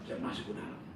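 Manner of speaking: ordinary talk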